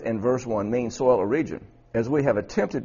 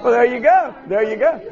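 high, loud, tickled encouragement; pitched right up, loud and gleeful; delighted, amused; 11 semitones higher, 7 dB louder than usual. Real, not acted. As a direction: cheer them on, still tickled from laughing. Delighted after laughing with class.

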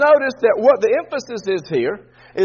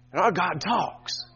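hushed drop; 5 semitones lower, 8 dB quieter, slower than usual. Real, not acted. Drops after the shout.

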